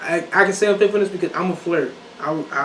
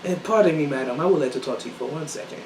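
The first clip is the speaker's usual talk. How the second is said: softer, lower formal request; courteous approach; 4 semitones lower, 6 dB quieter than usual. Shown as a demonstration. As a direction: politely beg a lady's attention, quietly. Demonstrating a formal polite approach.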